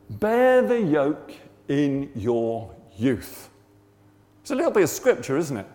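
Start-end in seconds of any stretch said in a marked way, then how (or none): 0.1-3.5 very slow, drawn-out solemn pronouncement; voice of God. Retold or imitated. God giving Rog a verse, slow solemn pronouncement. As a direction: stretch each word out weightily. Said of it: "this is what I felt God say".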